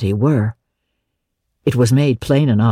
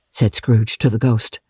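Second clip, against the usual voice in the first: ordinary delivery, nothing marked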